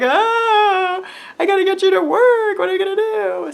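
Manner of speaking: high, loud, hammed-up wail; mock panic. Mom who must get son to work, panicked wail. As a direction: wail it fast in exaggerated panic.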